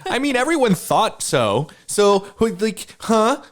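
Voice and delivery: squeaky mock indignation; high mock outrage. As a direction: go up high, playing offended.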